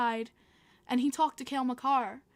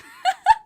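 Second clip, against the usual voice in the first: shrieking laughter; high squealing laugh; 15 dB louder than usual. Real, not acted. Laughing at the memory.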